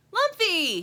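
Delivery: high, loud delighted cry; excited high-pitched shout. A narrator's reading. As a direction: cry the name out in greeting. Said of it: "he cries".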